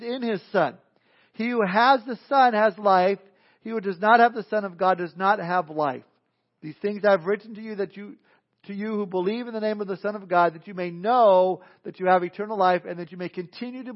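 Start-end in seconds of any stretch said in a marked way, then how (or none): none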